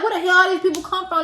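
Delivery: high, fast, stunned question; shocked disbelief. Retold or imitated. She sees the crowded store during the fight, stunned and fast. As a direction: blurt it out in disbelief. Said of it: "But I'm like".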